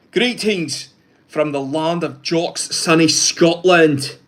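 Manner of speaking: slow, put-on Scottish accent; a hammed-up Scottish brogue, slow. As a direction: read the greeting like a Scot. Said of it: "good effort with the accent".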